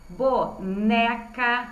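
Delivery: slow, separated bo-ne-ca for "boneca"; syllable by syllable. Shown as a demonstration. Teacher showing pupils the middle syllable, "boneca" syllable by syllable. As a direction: sound out "boneca" one long syllable at a time. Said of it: "Reparem que as sílabas com N".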